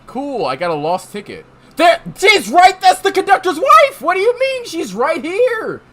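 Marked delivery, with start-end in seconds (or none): 1.8-5.8 indignant yell. Acted. Insisting the conductor's wife is here, indignant yell. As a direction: exclaim in shrieking disbelief. Loud mock outburst about wife.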